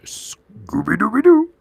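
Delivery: bad Scooby-Doo impression. Streamer voicing cartoon dog, failed impression. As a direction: ham up a cartoon dog, badly. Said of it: "That's not how he sounds".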